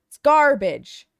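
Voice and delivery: disgusted venting. Real, not acted. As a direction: slam it as a slow, loud verdict. Venting over ruined stream.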